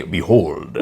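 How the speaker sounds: deep, grand biblical proclamation; Charlton Heston Moses voice. Shown as a demonstration. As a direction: boom it out like Moses on the mountain. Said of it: "doesn't sound like Moses".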